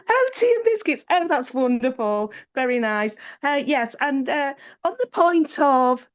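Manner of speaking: high, chirpy politeness; cheery tea and biscuits. Shown as a demonstration. Professional meeting, staying brightly positive. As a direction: breeze through it sweetly. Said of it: "So it becomes".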